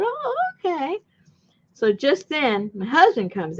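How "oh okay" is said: mock polite. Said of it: "I said".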